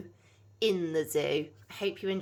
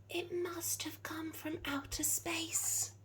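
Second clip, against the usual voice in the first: slow, higher, hushed whisper to himself; 11 semitones higher, 6 dB quieter, slower than usual. Acted. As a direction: breathe it in awe, slowly. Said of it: "he whispered to himself".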